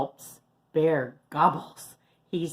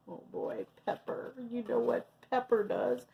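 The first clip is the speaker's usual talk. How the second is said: suspense aside; 6 semitones higher, 8 dB quieter, faster than usual. A narrator's reading.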